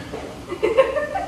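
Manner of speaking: airless laugh; breathy laugh. Real, not acted. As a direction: laugh without voice. Laughing at the roast.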